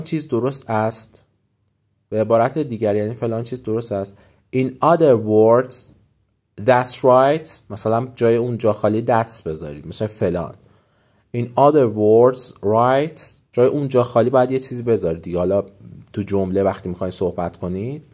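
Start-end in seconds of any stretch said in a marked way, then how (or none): 4.6-7.5 slow model phrase. Shown as a demonstration. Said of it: "teacher models phrase". 11.4-13.2 slow model phrase. Shown as a demonstration. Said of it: "teacher models phrase".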